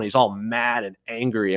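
usual speaking voice

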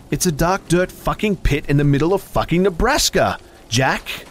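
high, loud taunting. A narrator's reading. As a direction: taunt him cruelly. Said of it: "she taunted".

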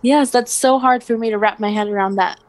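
normal talking voice

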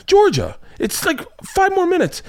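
high, strained mock exasperation, drawn out; exasperated dad. Dad answering daughter's homework complaint, exasperated. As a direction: plead like a dad at his limit.

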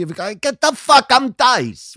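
high, loud tough-guy snap; Dice swagger. Retold or imitated. To a girlfriend who wants a family outing, high loud swagger. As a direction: puff up and declare it. Voicing his Dice persona.

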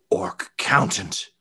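breathless whispered dread, slow; horrified whisper. Acted. Official recognizing the orc, whispering in horror. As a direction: whisper it as the realization hits. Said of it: "He says".

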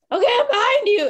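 high-pitched retold reply; urgent calling back. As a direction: call ahead urgently to your running partner. Calling back while running to gate.

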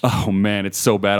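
usual speaking voice

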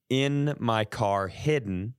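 normal talking voice

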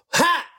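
short, high "ha!" of exertion; effort noise. Shown as a demonstration. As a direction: let out a sharp fight grunt. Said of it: "The efforting".

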